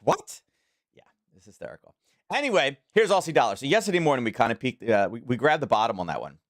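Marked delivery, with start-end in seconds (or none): 0.0-0.4 high-pitched mock-astonished "what"; retold disbelief, squeaky high; incredulous. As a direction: imitate a stunned judge, voice shooting way up. Judges' astonished reaction, retold.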